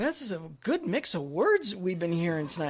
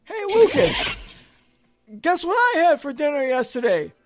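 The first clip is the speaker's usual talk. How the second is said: high, loud setup for a bit; hammy blooper setup; 6 semitones higher, 4 dB louder than usual. Acted. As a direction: pitch it up like a staged outtake. Acting out a staged blooper.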